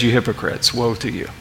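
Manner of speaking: dropped low and soft, a grave hushed woe; hushed rebuke. Acted. Preacher quoting Jesus to the Pharisees, hushed and grave. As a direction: let the condemnation sink to a near-hush.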